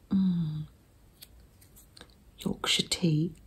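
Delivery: ordinary delivery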